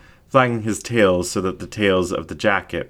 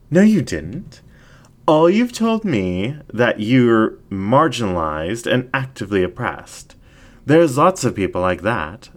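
seductive incubus ringmaster; 4 semitones higher than usual. Acted. Ringmaster questions Jack.

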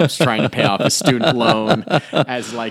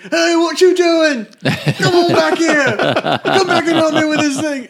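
very high, loud mock British painter calling out; high-pitched yelling imitation, laughing through it; Peter Mayhew impression; 7 dB louder than usual. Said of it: "this is exactly how he sounds".

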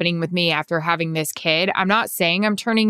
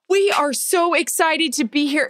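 high, slow, gushing retold greeting; imitated excitement; 7 semitones higher than usual. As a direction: gush it out as an imitation, pitched up. Retells excited greeting at sideline.

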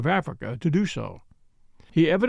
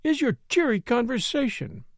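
higher, lightly malicious teasing; touch of malice; 8 semitones higher than usual. A narrator's reading. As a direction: needle him playfully in a higher woman's voice. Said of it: "with a touch of malice".

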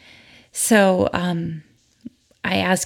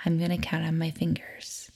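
whispered aside; whispering; 8 dB quieter than usual. Real, not acted. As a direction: murmur it under your breath. Aside during counting exercise.

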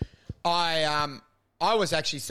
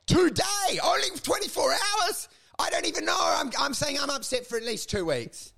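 mock outrage; 8 semitones higher than usual. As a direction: yelp it in indignant disbelief. Mocking grief time limits.

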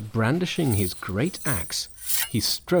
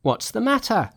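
high-pitched monkey demanding news; a quick, high question; Monkey asking; 10 semitones higher, 4 dB louder than usual. A narrator's reading. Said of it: "asked Monkey".

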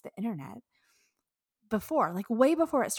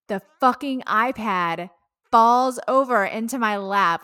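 much louder, slower, hitting each word in disbelief; exasperated outburst; 3 semitones higher, 13 dB louder than usual. Real, not acted. Telling viewers how the iPad fell during the chat, exasperated loud outburst. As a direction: blurt it out like the worst moment imaginable.

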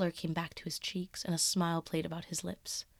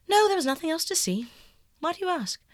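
much higher and louder; defensive echo; 10 semitones higher, 7 dB louder than usual. Acted. Dorian deflecting Basil's question, high and loud. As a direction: throw it back, defensive.

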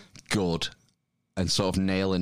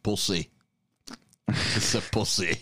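Northern English accent. Shown as a demonstration. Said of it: "stick to my Northern Game of Thrones".

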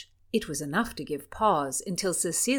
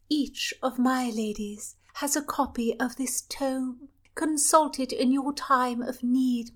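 high, confiding princess, ending in a whisper; lightly voiced sweet princess; 5 semitones higher than usual. Princess gives the book.

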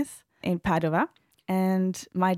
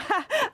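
tearful laugh. Real, not acted. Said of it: "it was a bit emotional".

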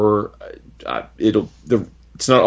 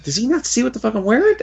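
high-pitched, laughing disbelief; mock incredulity; 14 semitones higher, faster than usual. Retelling Clint in costume beside Daredevil, high incredulous voice. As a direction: act out baffled disbelief, voice pitched way up. Retold hero's baffled reaction.

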